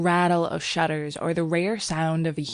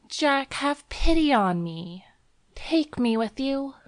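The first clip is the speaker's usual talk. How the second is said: put-on high-pitched young woman begging; pleading; 9 semitones higher than usual. A narrator's reading. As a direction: beg him as the anxious girl. Girl begs to go along.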